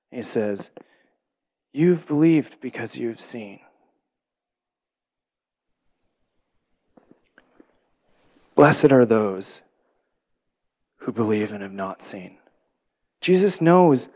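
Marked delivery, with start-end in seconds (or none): 1.7-12.4 tender statement. Shown as a demonstration. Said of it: "makes more sense for him to say it as a statement".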